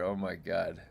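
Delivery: ordinary delivery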